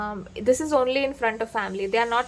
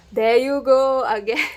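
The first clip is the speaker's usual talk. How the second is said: louder mock-exasperated groaning complaint; eye-rolling exasperation; 3 semitones higher, 7 dB louder than usual. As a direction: act fed up with a cheesy partner, loudly. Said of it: "going to roll your eyes at their".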